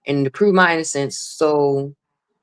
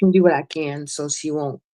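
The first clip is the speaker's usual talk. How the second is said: ordinary talk, nothing marked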